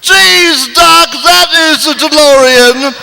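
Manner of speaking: Bill Cosby. Acted. Marty marvelling at Doc's car, Bill Cosby voice. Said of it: "Marty, Bill Cosby".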